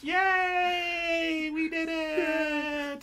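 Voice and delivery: very high, slow drawn-out cheer; high stretched victory yay; cheering crowd. Retold or imitated. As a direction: cheer like a crowd of little cells. Everyone's cheering.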